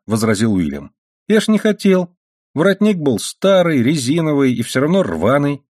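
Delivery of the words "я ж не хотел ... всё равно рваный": put-on high-pitched boy making excuses; higher voice, pleading his innocence; protesting boy. A narrator's reading. Son defending himself to his mother, protesting.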